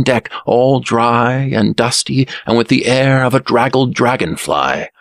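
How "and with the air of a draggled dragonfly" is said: ordinary delivery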